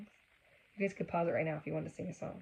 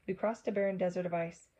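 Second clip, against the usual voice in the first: ordinary delivery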